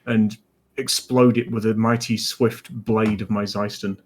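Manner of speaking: normal talking voice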